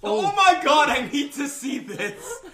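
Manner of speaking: loud, high, drawn-out laughing cry; real excited outburst. Real, not acted. Friend hearing about an absurd movie, giddy outburst.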